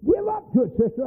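high coaxing call; urging church folk. Retold or imitated. Folks at altar urging sister, high coaxing. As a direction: egg her on like the church folks. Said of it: "where folks".

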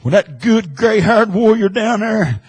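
high, loud, driving sermon delivery; full-force preaching. Real, not acted. Preacher stirring up the congregation. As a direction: preach it hard with fire.

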